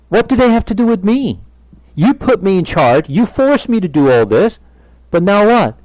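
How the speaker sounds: loud, high-pitched outraged protest; exasperated servant at the end of his rope; indignant Moses. Acted. Acts out Moses protesting to God.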